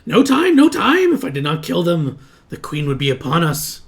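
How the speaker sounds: high, loud panicked goblin voice; frantic goblin. Acted. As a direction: plead urgency, starting at a squeal and easing down. Captive goblin excuses himself urgently.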